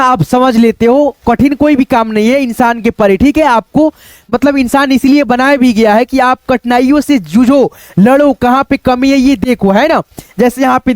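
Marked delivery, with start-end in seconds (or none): none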